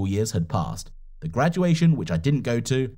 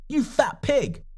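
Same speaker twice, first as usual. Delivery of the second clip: high, self-hating shout; scream; 11 semitones higher, slower than usual. Acted. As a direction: scream it at yourself in disgust. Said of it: "I screamed out to the whole apartment".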